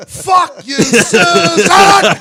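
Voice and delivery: high, loud mock snap call; hollered joke cadence; quarterback cadence. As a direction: yell nonsense signals down the line. Acting out joke snap calls.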